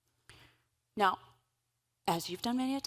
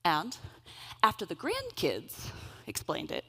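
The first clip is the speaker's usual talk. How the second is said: usual speaking voice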